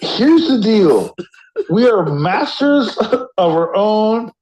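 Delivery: slow and grand, pitched high; lofty declaration, drawn out; dramatic speech. Acted.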